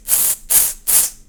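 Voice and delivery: z as a hissed ts; sharp ts for z; German z sound. Shown as a demonstration. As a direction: hiss the z sound. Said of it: "Z in the beginning".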